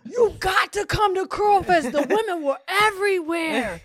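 loud, high shout of a hyped-up guy; screaming into phone. Retold or imitated. Boy yelling to his friend about Curl Fest, screaming. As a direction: holler it down the phone, imitating him.

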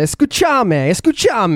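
loud, high Argentinian Spanish call. Retold or imitated. Showing how Argentinians talk, a loud high Argentinian call. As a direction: belt it out like a porteño waving his hands. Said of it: "what do you say?".

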